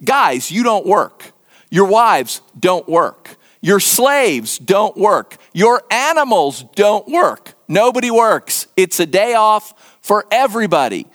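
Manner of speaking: each "don't work" hammered out slowly; slow, deliberate list, weight on every "don't work"; really emphatic. Acted. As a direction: lay down the rule emphatically, phrase by phrase. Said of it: "he gets really emphatic about that".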